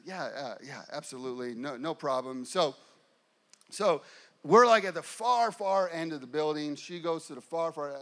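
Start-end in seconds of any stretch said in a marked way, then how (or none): 0.0-2.4 soft, low, subdued reply; hushed, obliging murmur; meekly agreeable. Retold or imitated. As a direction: agree quietly and humbly, a bit sheepish. Meek agreeable reply to mom.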